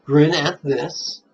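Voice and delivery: slow, drawn-out grin at this; unhurried teaching demo; slow demo recitation. Shown as a demonstration. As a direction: say it slowly, like an inner recitation. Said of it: "it's very simple".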